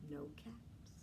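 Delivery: put-on hushed whisper, drawing children in; whispered suspense. A narrator's reading. Storyteller to children, whispered no caps. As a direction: breathe it out like a secret.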